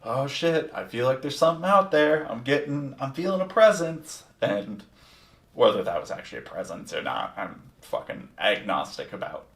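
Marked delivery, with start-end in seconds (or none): 0.0-4.2 spooked. Retold or imitated. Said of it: "I'm like".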